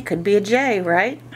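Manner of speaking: normal talking voice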